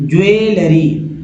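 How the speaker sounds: ज्वेलरी said slowly as jue-luh-ree, not leri; careful, slow teaching take of ज्वेलरी; slow model pronunciation. Shown as a demonstration. Teacher correcting class, slow careful ज्वेलरी. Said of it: "लेरी नहीं".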